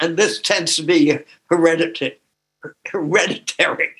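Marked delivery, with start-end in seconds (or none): none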